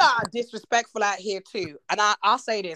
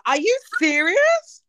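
high, loud, drawn-out disbelief; astonished, climbing pitch; real shock; 8 semitones higher, 5 dB louder, slower than usual. Real, not acted. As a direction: stretch the question out, rising in amazement. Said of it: "that really shocked me".

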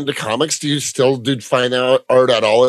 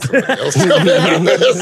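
giggly and fast, stoned laughter; laughing; 5 semitones higher, faster than usual. Real, not acted. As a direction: laugh it off, a bit high. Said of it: "I'm stoned".

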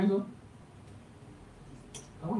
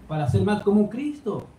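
higher, pleading preacher's appeal; sermon at full force, higher and urgent; impassioned preaching; 7 semitones higher than usual. Real, not acted. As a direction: press the congregation, rising in fervour. Preaching climax, raised pitch.

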